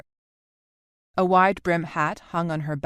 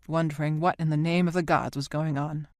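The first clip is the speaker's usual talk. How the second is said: plain reading voice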